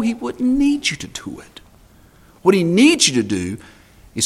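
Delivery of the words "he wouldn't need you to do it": whispered aside. Acted.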